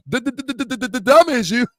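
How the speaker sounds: stammered run-up, then a blurted punchline; Uncle Pete. Retold or imitated. Imitating his stuttering uncle for the channel, stuttered taunt. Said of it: "my uncle stutter".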